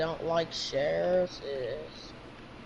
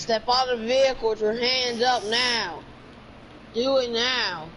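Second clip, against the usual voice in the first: loud, higher barked order; shouted police command; 5 semitones higher, 5 dB louder than usual. Acted. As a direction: yell it like a cop at a traffic stop. Cop ordering suspect out.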